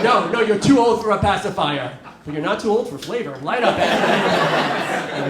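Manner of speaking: loud, coaxing parent; mock coaxing. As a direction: coax a child into a smoke. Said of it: "it's like".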